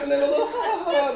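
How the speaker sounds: high little ha ha; light high chuckle; little laugh demo. Shown as a demonstration. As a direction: show them a tiny laugh. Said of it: "A little big laugh".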